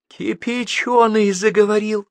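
high, slow, amazed cry; astonished exclamation. A narrator's reading. Chief gasping as the possessed man speaks, astonished. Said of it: "ахнул Пётр Алексеевич".

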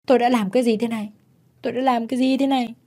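high, horrified disbelief; flustered. A narrator's reading. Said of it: "luống cuống nói".

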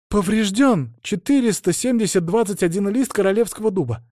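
high-pitched, dutiful recital; reporting novice. A narrator's reading. Novice lists the elves' grievances against the saint, reporting. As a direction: reel off the damages as a formal report. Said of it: "отчиталась послушница".

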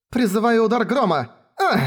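high shouted incantation; shouted spell. Acted. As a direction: cry out the spell. Girl casts spell in fight.

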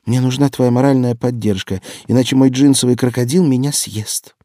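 fervent plea. A narrator's reading. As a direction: plead dramatically for backup. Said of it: "жарко прошептала она в трубку". (жалобная горячая просьба)

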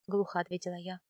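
plain reading voice

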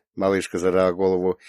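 ordinary delivery, nothing marked

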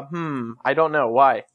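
ordinary talk, nothing marked

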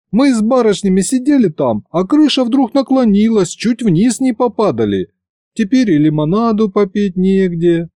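put-on high, lively young woman's voice; Varya voiced. A narrator's reading. Varya tells Fedya about the tilting roof, excited and high.

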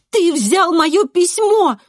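high, loud, stretched accusation; accusing shout. A narrator's reading. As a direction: shout it right in his face. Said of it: "кричу я". (обвиняющий крик)

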